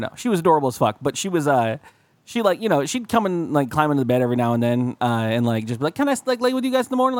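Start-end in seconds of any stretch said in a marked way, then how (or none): 5.9-7.2 lightly voiced kid, higher and quick; a hint of a little kid asking. Little kid asking to climb into bed, lightly voiced child. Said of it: "kid was".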